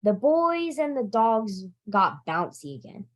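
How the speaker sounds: usual speaking voice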